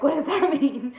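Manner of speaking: high, loud, laughing question; laughing disbelief. Retold or imitated. To the nurse, asking what it means, laughing loud.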